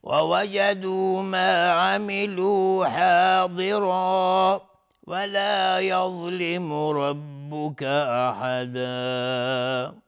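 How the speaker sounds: slow, melodic tartil chanting; Quran recitation. Acted. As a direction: draw out every verse with a flowing chant. Quran recitation before tajweed explanation. (تلاوت آهسته و آهنگین قرآن)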